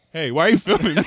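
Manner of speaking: fake-confront him while cracking up; laughing mock accusation. Acting out prankster's confrontation.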